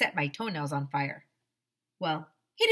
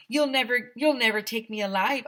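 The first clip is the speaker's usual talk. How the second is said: louder, higher cry; defiant shout; 5 semitones higher, 6 dB louder than usual. A narrator's reading. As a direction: yell it as you bolt. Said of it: "I shouted".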